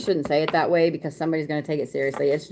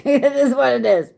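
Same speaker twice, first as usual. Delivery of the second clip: louder, higher, with a laugh; 5 semitones higher, 6 dB louder than usual. Real, not acted. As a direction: shrug it off through a laugh. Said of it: "laughing repeat".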